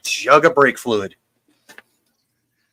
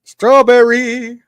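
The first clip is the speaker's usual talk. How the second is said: slow echo; 9 semitones higher, 5 dB louder, much slower than usual. Pretend. Echoing the drawn-out word.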